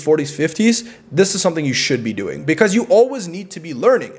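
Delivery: usual speaking voice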